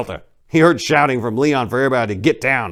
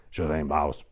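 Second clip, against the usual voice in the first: lower, softer yes-man; henchman reply; 5 semitones lower, 5 dB quieter than usual. Acted. Devon answers his boss in a low quiet voice. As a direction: mumble assent to the boss.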